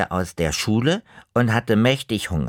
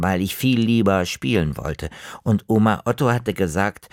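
plain reading voice

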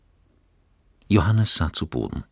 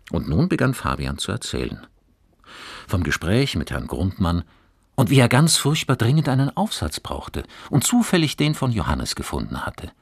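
plain reading voice

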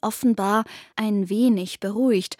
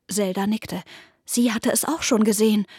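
plain reading voice

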